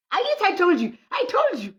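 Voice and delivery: high, loud proud "I told you"; crowing with glee, pitch way up; excited boast. Real, not acted. Teasing boast to laughing listener.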